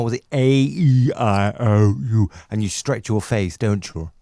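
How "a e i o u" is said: slow, drawn-out vowels A, E, I, O, U; vocal exercise. Shown as a demonstration. Co-host demonstrating singers' warm-up, A, E, I, O, U stretched slowly. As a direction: stretch each of A, E, I, O, U long and exaggerated. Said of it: "doing my vocal exercises now".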